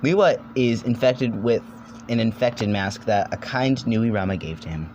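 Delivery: ordinary talk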